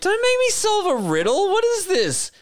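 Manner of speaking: hammed-up high-pitched whine of complaint; mock indignant protest. Actor balking at a riddle put on the spot, mock indignant.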